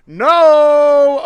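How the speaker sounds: a loud cry of no; sudden loud no. Real, not acted. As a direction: bellow it out, cutting everything off. Sudden outburst at the crowd.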